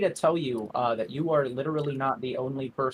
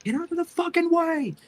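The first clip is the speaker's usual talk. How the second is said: high, shouted warning; furious shout. Acted. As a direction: yell it at the crowd to move. Character yells at others.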